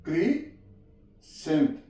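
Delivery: क्रीसेंट stretched very slowly with a falling end; slow model word. Shown as a demonstration. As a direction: drag out क्रीसेंट for the learner. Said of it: "यहाँ पे सी silent आ गया". (क्रीसेंट बहुत धीरे)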